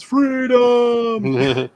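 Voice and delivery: high, stretched-out rallying cry; mock battle cry. Host reads Jordan's message as a mock battle cry.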